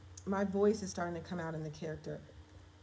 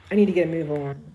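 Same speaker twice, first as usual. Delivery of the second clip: louder, gruffer try at a guy's line; man's phrasing; 3 semitones lower, 12 dB louder, faster than usual. Shown as a demonstration. Author tests a man's line aloud, louder. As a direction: try it like a guy would. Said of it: "y'all probably would say".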